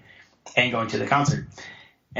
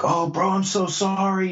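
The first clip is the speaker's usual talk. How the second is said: high, drawn-out mock apology; gushing apology; 7 semitones higher than usual. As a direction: imitate an over-sorry bro, slow and pitched up. Retold apology after the punch.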